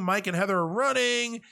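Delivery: normal talking voice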